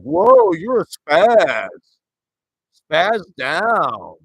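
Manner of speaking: squealed playground insult stretched out; mocking bully. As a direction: jeer like a schoolyard bully. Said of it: "be like".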